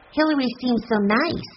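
high, gentle objection; protesting young girl. A narrator's reading. Violet defends Hillary to her sister, high protesting voice.

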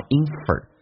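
"infer" with a reduced second syllable; 弱读示范. Shown as a demonstration. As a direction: say "infer" for the start of information, weak "fer". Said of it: "不管英式还是美式都不发information". (“infer”第二音节弱读)

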